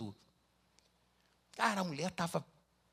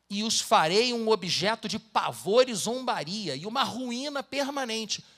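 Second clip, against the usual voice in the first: louder and higher, slowing to a heavy close; solemn scripture reading; 6 semitones higher, 6 dB louder, slower than usual. Acted. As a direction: thunder out God's judgment. Reads scripture aloud.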